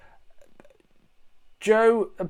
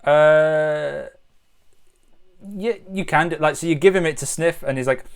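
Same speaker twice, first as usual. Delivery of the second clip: ordinary delivery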